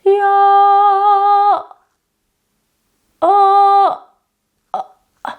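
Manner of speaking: loud high Ya, oh choked off into uh, uh; closing the pipe. Shown as a demonstration. Teacher showing the wrong, choked ending, loud then cut. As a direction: clamp the throat shut at the end. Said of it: "So you don't wanna go".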